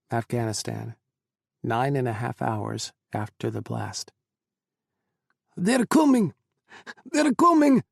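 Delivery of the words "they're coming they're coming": high, loud alarmed cry; urgent shout. Acted. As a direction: yell the warning.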